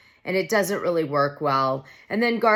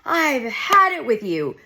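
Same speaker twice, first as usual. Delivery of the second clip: high, loud hammed-up outburst; mock flip-out; 7 semitones higher, 4 dB louder than usual. Dorit blowing up at Garcelle over nothing, hammed-up and high. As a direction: fake a dramatic blowup at Garcelle. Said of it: "Dorit should have flipped out over nothing".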